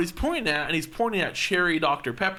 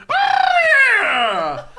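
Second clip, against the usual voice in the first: sudden high yelp of oh yeah; retold shout; 9 dB louder than usual. Stranger yelling back at them in the aisle, loud yelp. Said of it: "turns around and goes".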